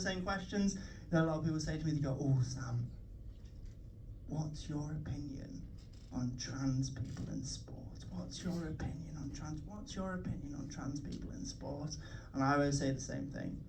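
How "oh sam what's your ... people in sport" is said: hushed, low and slow; mock-earnest questioner. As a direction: imitate someone gently probing on a delicate topic. Imitating people asking a solemn question.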